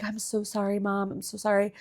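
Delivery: son's quiet apology. Retold or imitated. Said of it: "he was just like".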